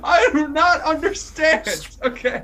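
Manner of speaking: high, slow, loud dramatic diva; exaggerated diva voice. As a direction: overplay it like a diva.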